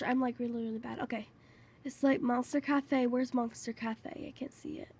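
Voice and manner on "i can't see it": breathy whisper under the breath; a hushed, unvoiced aside. Real, not acted. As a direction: mutter it to yourself in a whisper. Whispered aside while searching.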